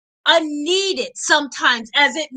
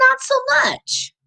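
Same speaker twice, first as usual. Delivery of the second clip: high, put-on sly reply; knowing helper; 11 semitones higher than usual. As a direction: tease him that you know the way.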